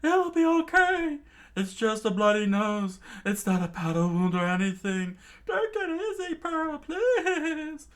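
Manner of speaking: high, loud frantic pleading; shrieked high, pleading in panic; panicked crowd screaming. A narrator's reading. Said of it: "freaking out and started screaming".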